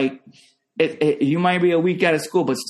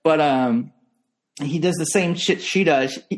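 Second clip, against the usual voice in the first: normal talking voice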